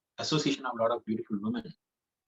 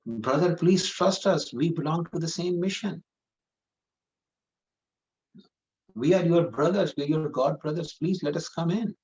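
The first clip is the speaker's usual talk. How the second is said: raised pleading voice; 5 semitones higher than usual. Acted. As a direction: beg the brother to let them in. Begging monks at the door.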